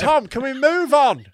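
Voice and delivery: high, loud protest; exasperated plea. Real, not acted. Host begging his co-host to move on, exasperated. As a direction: cut in loudly to stop the bit.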